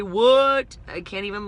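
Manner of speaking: a loud, startled "What?"; surprised. Real, not acted. Telling viewers it's cold in Texas, loud surprise. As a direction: blurt it out in disbelief.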